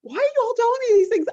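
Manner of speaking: amused exasperation at strangers oversharing; mock exasperated. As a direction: throw up your hands in disbelief. Retold exasperated question.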